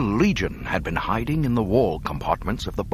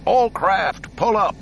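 very high, loud command to all; commanding shout; 13 semitones higher, 6 dB louder, slower than usual. Acted. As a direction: order everyone up at full voice. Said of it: "Lando commanded".

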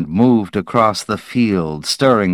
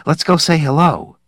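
higher, eager voice; excited invitation; 8 semitones higher than usual. Acted. Barton urging crew to greet the kids, eager. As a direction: say it bursting with eagerness. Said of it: "He laughed excitedly".

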